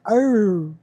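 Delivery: drawn-out, falling "arooo"; correcting in husky. Shown as a demonstration. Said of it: "'cause that".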